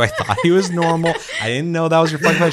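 mock panic, caught shooting the pet; panicked excuses. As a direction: babble your defence high and fast. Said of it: "And I was like".

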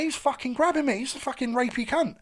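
high-pitched, outraged complaint; indignant protest. Retold or imitated. Telling the bouncers about the groper, high and indignant. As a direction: plead your case, outraged.

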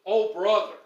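a loud, dismayed Oh, brother; loud exclamation. Shown as a demonstration. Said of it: "That's how we say it in our language today".

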